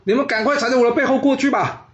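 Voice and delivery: loud call. A narrator's reading. Soldier throws himself on the fire, shouting urgently. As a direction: cry out to them over the fire. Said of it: "并且大声说".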